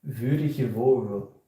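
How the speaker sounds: put-on loud, drawn-out Flemish accent. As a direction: call it out slowly in your best Flemish accent. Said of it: "my best Flemish accent".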